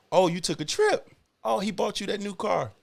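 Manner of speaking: sarcastic high gossip voice. Retold or imitated. Mocking outsiders' comments.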